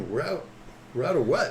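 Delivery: confused learner. Retold or imitated. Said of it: "from Japan".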